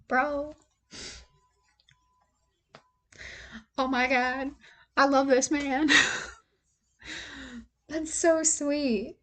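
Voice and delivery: barely holding it together over a touching letter; choked up. Real, not acted. Reader moved by the letter, choked up and breathy-high. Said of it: "I need to pause. Give me a second".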